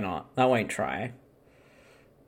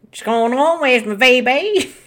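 exaggerated Aussie accent; 5 semitones higher than usual. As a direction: whine for a beer in mock Aussie. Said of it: "even more exaggerated versions of them".

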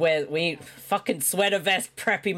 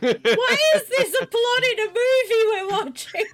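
high, incredulous squeal; laughing disbelief. Real, not acted. Co-host appalled by the movie's plot, high laughing disbelief. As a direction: question it at high pitch through a laugh.

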